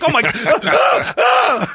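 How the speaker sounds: high, loud, drawn-out mock panic; retold terrified cry. Scared man facing police lights, retold panicked high cry. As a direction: gasp it out in exaggerated fright. Retold fright at sudden command.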